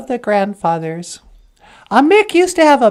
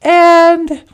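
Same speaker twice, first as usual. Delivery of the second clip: plain reading voice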